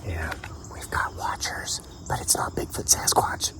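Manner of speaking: hushed aside, barely voiced; whisper. Real, not acted. To listeners, noticing people watching him in the woods, whispered. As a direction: breathe it so the watchers can't hear.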